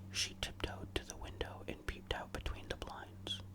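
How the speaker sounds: usual speaking voice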